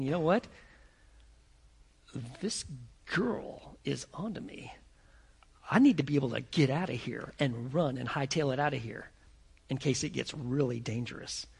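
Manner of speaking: low, soft, partly whispered; Peter's thoughts. Acted. Peter planning his escape, hushed inner voice. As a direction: think it aloud, hushed and wary.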